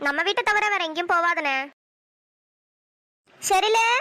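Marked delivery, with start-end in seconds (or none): 3.4-3.9 calling out. Acted. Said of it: "செரிலே அப்படின்னு கூப்பிட்டா".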